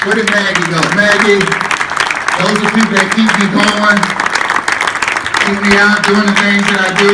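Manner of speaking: loud rally to the crowd; speech to the crowd. Acted. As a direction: project it out to the whole room. Addresses crowd, thanks team.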